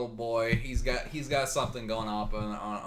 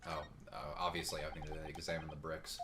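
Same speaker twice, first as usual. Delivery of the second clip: lower, muttered character read; low male character voice; 7 semitones lower, 10 dB quieter than usual. Acted. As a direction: voice the young lawyer, low.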